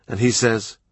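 plain reading voice

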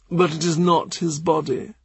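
lightly voiced measured churchman; Cranmer.

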